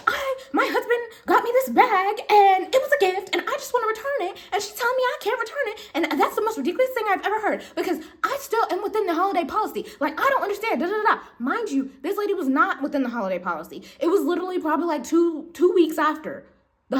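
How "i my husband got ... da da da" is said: high, fast, indignant complaint; imitating a customer ranting at staff; whiny customer impression. Rude customer to coworker, high indignant whine.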